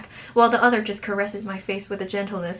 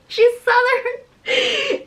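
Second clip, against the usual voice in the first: high, loud Southern reveal wailed out; laughing shriek; 7 dB louder, much slower than usual. Real, not acted. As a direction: squeal it slowly, cracking up. Cracks up reading character's accent.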